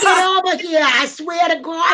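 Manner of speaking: hammed-up Bronx accent; New York Bronx accent. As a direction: scold in broad put-on New York. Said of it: "don't you like my New York Bronx accent".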